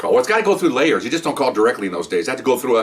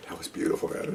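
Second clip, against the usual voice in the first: soft, whispered praise; whispered aside; 6 semitones lower, 13 dB quieter than usual. Retold or imitated. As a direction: whisper it to the prankster. Head detective's aside.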